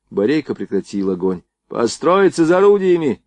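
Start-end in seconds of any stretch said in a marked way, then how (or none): 1.7-3.2 high-pitched parade command; raised commander's voice; giving an order. A narrator's reading. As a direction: order the men to fall in. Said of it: "приказал он".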